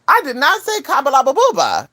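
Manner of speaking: high-pitched protest; indignant denial. Acted. Speaker denying what people heard, indignant denial. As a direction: deny it hotly, pitch jumping up.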